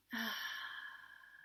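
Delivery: long, soft sighing exhale; relaxed sigh out; demonstrated out-breath. Shown as a demonstration. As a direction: release the breath slowly and audibly. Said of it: "and breathing out".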